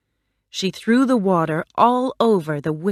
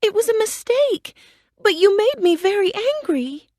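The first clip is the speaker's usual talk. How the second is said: put-on high, apologetic girl voice; a girl apologizing quickly; sorry Dorothy. A narrator's reading. Dorothy apologizing to the melting witch, high and contrite. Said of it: "Dorothy said".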